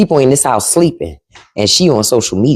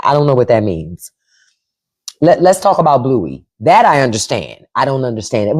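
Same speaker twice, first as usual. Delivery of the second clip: normal talking voice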